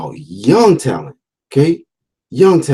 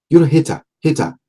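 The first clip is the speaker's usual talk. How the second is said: "You're a hater, hater" in a clumsy put-on British accent, pitched higher; botched British accent that slips toward Nigerian, higher pitch; failed British accent; 7 semitones higher than usual. Vlogger mocking haters, in a botched British accent. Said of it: "sounds like a Nigerian accent or I can't do the British accent".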